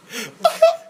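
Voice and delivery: very high, hammed-up sob; mock wailing sob. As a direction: burst into exaggerated blubbering. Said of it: "that's how I was acting like".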